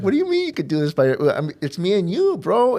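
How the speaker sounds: high, loud, quickened disbelief; hurt protest. Retold or imitated. Dad objecting when his son wants to build it alone, hurt protest. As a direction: plead your case, pitch climbing high and loud.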